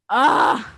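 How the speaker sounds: loud, drawn-out mock "ah"; sudden exaggerated open-mouthed "ah"; imitated exclamation. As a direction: cry out as the lecturer discovering it. Imitating lecturer finding the peg.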